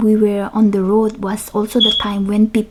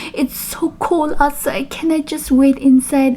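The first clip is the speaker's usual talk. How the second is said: pleading; 5 semitones higher than usual. Retold or imitated. Pleading with the guard.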